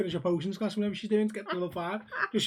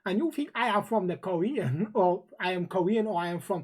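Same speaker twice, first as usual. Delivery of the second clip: put-on Uncle Roger accent. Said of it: "if I talk like Uncle Roger".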